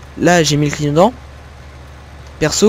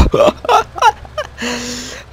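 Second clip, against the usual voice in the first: laugh; 8 semitones higher than usual. Real, not acted. Laughing at tractor drift.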